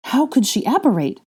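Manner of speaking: high startled outburst; shocked disbelief. A narrator's reading. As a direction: blurt it out in shock.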